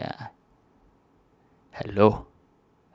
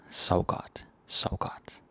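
Sov godt whispered, softer each time; 6 dB quieter, slower than usual. Shown as a demonstration. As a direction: hush Sov godt as if tucking someone in. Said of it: "goodnight phrase softly".

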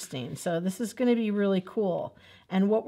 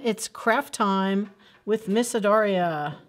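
usual speaking voice

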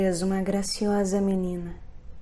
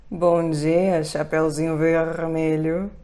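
sugary, louder wolf greeting; coaxing wolf voice; 3 semitones lower, 3 dB louder, slower than usual. A narrator's reading. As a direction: sweet-talk her like a sly wolf. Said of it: "disse o lobo todo dengoso".

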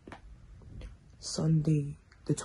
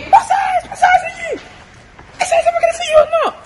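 high, loud playful yelling; shouting; 14 dB louder than usual. Real, not acted. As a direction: yell it across the room. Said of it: "why you shouting".

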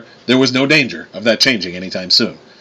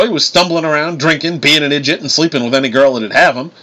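put-on southern accent; 6 semitones higher than usual. A narrator's reading. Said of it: "you suck at that southern accent".

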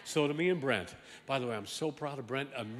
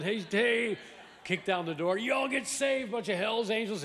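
mock shout; 6 semitones higher, 4 dB louder than usual. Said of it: "mock biker preacher".

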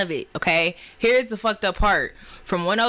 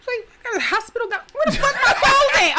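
panicked confusion; 12 semitones higher than usual. Retold or imitated. Waking in a hospital gown, fast high panicked confusion. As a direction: rush it out, bewildered.

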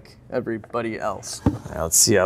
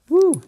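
excited whoop; 8 dB louder than usual. Real, not acted. Surprised at crusty plugs.

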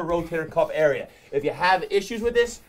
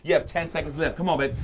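normal talking voice

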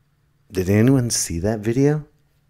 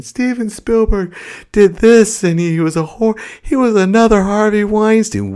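high, incredulous mock accuser; high-pitched hammed-up indignation; mock outrage; 13 semitones higher, 4 dB louder than usual.

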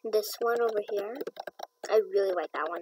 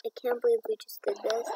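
ordinary talk

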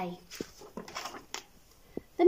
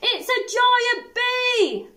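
terrified bear; 12 semitones higher, 6 dB louder, much slower than usual. Acted.

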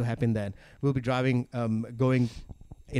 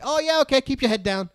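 mom voice; 13 semitones higher, 3 dB louder than usual. Retold or imitated. Mom replying casually.

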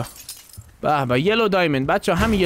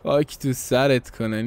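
ordinary talk

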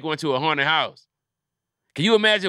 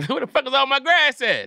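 mock outrage; 11 semitones higher than usual. Outraged owner quote.

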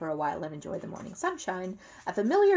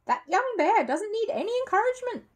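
much higher, louder housekeeper; a disapproving housekeeper, pitched up; housekeeper's voice; 5 dB louder than usual. A narrator's reading. Housekeeper grumbles about the bear, high and disapproving. Mrs Bird voiced in story.

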